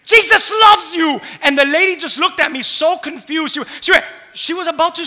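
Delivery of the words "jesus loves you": slow, loud and high proclamation; loud slow shout. Retold or imitated. Pastor recalling his words to the driver, loud and slow. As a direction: declare it firmly. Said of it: "all I said was".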